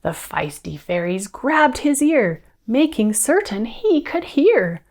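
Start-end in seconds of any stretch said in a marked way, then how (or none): none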